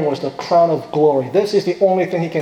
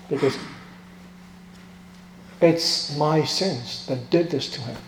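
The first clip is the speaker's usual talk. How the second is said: normal talking voice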